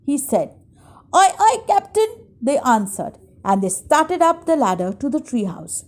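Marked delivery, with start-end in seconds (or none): 1.2-2.1 high-pitched crew reply; high pirate henchmen; obedient pirates. A narrator's reading. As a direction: answer the captain eagerly, pitched way up. Pirates answer their captain's order.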